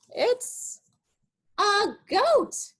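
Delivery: high little goat voice; high goat reveal. Pretend. Teacher reveals the goat in a high voice. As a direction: squeak out the answer high.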